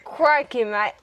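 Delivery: broad attempt at Australian; Australian accent. Shown as a demonstration. Sibling copying the Australian demo, exaggerated accent. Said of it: "The Australian".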